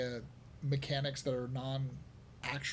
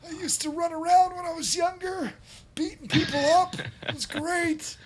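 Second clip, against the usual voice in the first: put-on much higher, louder creaky old man; 8 dB louder than usual. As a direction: play a feisty old geezer. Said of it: "that's my old man voice".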